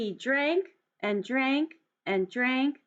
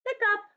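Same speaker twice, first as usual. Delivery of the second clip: high sharp hiccup sound; 10 semitones higher than usual. Acted. Storyteller voicing elephant's hiccup, high and sudden. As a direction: pop out a hiccup, much higher.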